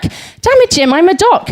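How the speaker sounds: high-pitched, lightly put-on Welsh accent. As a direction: exclaim it in a singsong Welsh accent. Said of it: "he said in a remarkably Welsh accent".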